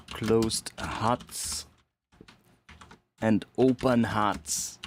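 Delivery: put-on playful German accent, slow on open huts; joking German accent on "huts". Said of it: "I suddenly decided to do a German accent".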